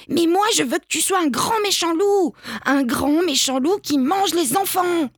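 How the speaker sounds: put-on high, whining little-kid demand; insistent child. A narrator's reading. Child insists, acted tantrum.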